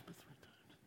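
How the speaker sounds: a hushed, ashamed admission; whispered confession. Acted. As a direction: whisper it in shame. Peter confessing his denial.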